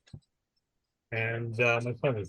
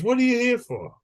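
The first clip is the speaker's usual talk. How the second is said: mock vet voice; 9 semitones higher than usual. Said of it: "the vet goes".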